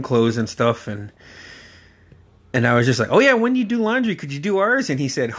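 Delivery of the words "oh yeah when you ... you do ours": high, innocent request; naive kid asking. Retold or imitated. Boys asking uncle to do their laundry, high and innocent. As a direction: ask like a clueless kid who expects a yes.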